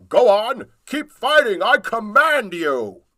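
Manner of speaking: high, loud commanding goblin voice; goblin king. Acted. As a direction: order them to keep fighting.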